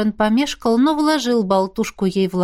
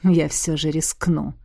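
low, slow, smirking reply; 4 semitones lower, slower than usual. A narrator's reading. Moran takes up Miranda's dare, smirking low. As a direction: answer slowly with a sly grin. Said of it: "ухмыльнулся он".

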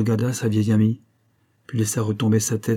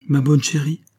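higher, louder cry to a dear friend; exclaimed greeting; 6 semitones higher, 5 dB louder than usual. A narrator's reading. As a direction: exclaim it with raised pitch. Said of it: "s'écria Rose".

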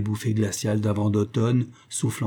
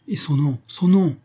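rising higher and louder on son nom; eager demand; 6 semitones higher, 5 dB louder than usual. Acted. Raymond pressing the old woman for the name, eager. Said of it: "demande vivement Raymond".

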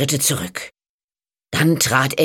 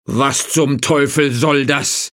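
angry. A narrator's reading. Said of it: "wandte der Bauer sich zornig".

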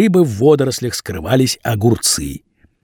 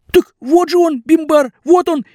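high, aggrieved pleading; pleading young man; 3 dB louder than usual. A narrator's reading. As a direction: plead innocence in a higher voice. Vasya eager answer.

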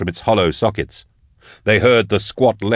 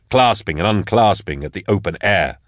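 ordinary delivery, nothing marked

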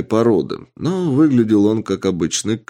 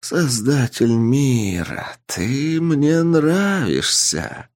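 slow, high inhuman voice; an ancient being pleased and amused; eldritch horror; 5 semitones higher, much slower than usual. Acted. The entity replies, amused. (медленный высокий потусторонний голос)

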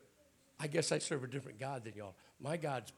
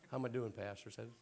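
ordinary talk, nothing marked

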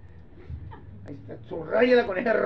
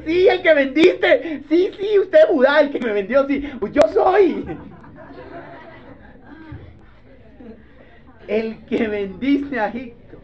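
half laugh, half sob at your brother; tearful confrontation; 5 semitones higher, 5 dB louder than usual. Acted. Joseph to Judah, laughing and crying.

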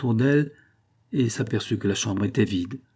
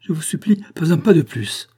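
high, loud frightened begging; desperate plea; 7 semitones higher, 6 dB louder than usual. A narrator's reading. Andrée barring the door, begging in high panic. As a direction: beg him to stop, in panic. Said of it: "ses bras étendus fermaient le passage".

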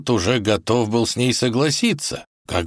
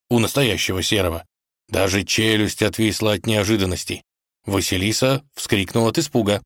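usual speaking voice

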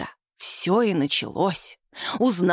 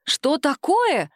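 higher, drawn-out questioning cry; narrated startled exclamation, pitched up; alarmed cry; 5 semitones higher, much slower than usual. A narrator's reading. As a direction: cry out in alarm without real shouting. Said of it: "закричали хором тётушка с Симой".

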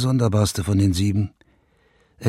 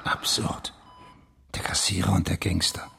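low whisper to himself; 3 semitones lower, 6 dB quieter than usual. Acted. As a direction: whisper it as a dark realisation. Murmelt nachdenklich für sich. (nachdenklich geflüstert)

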